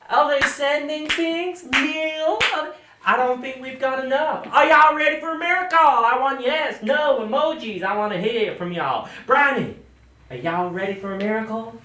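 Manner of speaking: booming Southern American preacher, high and loud; over-the-top American televangelist accent. Acted. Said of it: "I'll go back to my normal accent".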